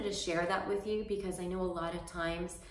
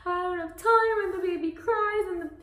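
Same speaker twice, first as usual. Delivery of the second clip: very high, loud, tearful mock rant; imitated sobbing rant; 13 semitones higher, 7 dB louder than usual. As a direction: pour it out like a frazzled mom crying at the salon. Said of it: "I was crying".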